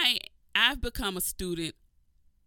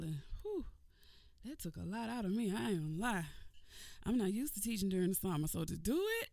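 ordinary delivery

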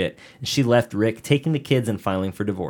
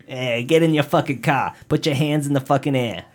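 barked Boston cop orders, a bit louder; Boston cop accent; 5 semitones higher, 4 dB louder than usual. Retold or imitated. Cop ordering a driver out, Boston accent. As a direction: play a gruff Boston cop yelling at a driver. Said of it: "All cops sound like Boston cops".

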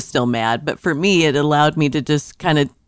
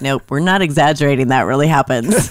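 ordinary talk, nothing marked

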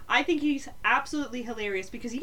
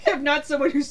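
laughing hard while talking; words bursting through laughter; 5 semitones higher, 8 dB louder than usual. Real, not acted. Laughing at the joke.

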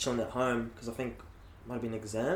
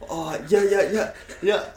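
higher, louder, thrilled yeses tumbling out; excited yes; 8 semitones higher, 8 dB louder than usual. Retold or imitated. Accepting the coach's call-up, higher and louder, overjoyed. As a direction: gush eagerly down the phone. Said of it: "I was, like, stoked".

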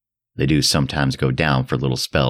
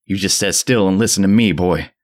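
put-on mad frenzied old man; 5 semitones higher than usual. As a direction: rave at the boy in frenzy. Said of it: "the mad frenzy of his voice".

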